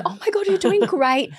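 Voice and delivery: high, bright-voiced reassurance; gushing reassurance. Stranger reassuring tired mum, gushing high. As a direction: lift her up with praise. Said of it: "she was like".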